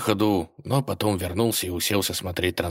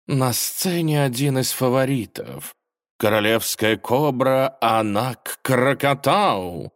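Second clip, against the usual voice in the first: booming prize announcer; 6 semitones higher, 4 dB louder, slower than usual. Acted. Host announcing the cobra.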